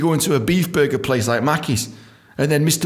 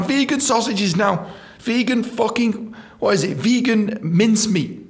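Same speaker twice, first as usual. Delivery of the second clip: ordinary talk, nothing marked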